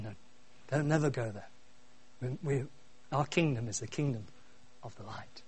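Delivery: low, soft, measured father; lightly voiced Mufasa. Preacher retelling Mufasa warning Simba, lightly voiced, low and hushed. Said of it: "Mufasa's standing on the hill".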